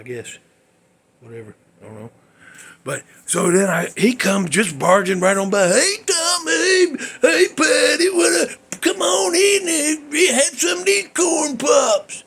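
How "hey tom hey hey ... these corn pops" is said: much higher, loud, slow, laughing caricature voice; loud goofy greeting. Retold or imitated. Mocking the guest barging in with greetings, high loud cackly voice. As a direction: boom in like an overbearing houseguest.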